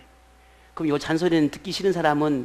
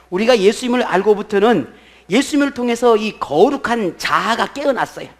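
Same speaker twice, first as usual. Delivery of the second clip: raised, emphatic sermon voice; forceful preaching; 6 semitones higher, 7 dB louder than usual. Real, not acted. Pastor proclaiming the awakened holy self, forceful preaching. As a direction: proclaim it to the congregation.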